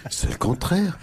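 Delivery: low-pitched, halting caricature of a former president; Nicolas Sarkozy. Acted. Husband explains his art book to his wife, low caricatured politician voice. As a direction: speak low, in self-justifying bursts. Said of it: "l'ancien président Nicolas Sarkozy".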